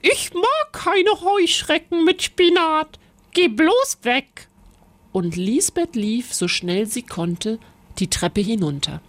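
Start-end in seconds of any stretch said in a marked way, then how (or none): 0.0-4.5 higher, chase her away in disgust; disgusted witch. A narrator's reading. The cooking witch sends Lisbeth away, disgusted. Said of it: "rief die Hexe".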